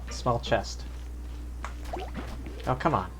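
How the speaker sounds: ordinary talk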